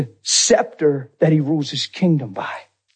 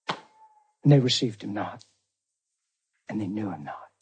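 hushed, grave; 7 semitones lower, 10 dB quieter than usual. Real, not acted. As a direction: drop to a solemn near-murmur. Solemn drop after truth rejected.

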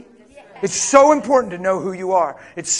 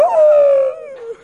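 high whooping laugh; 7 dB louder than usual. Real, not acted.

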